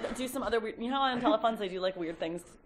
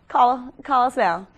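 slow loud appeal; 10 dB louder, much slower than usual. Acted. Urging viewers to phone in, slow telethon plea.

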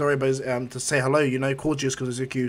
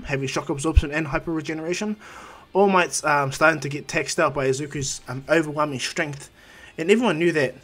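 ordinary delivery

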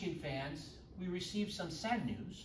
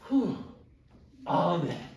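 relieved amen; 7 dB louder, slower than usual. Pretend. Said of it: "and you should all go".

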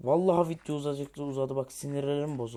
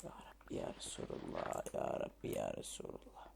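unvoiced, exasperated whisper; whispered prayer; 13 dB quieter than usual. Real, not acted. Gamer whispering to God in frustration. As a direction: breathe it out as a plea for patience.

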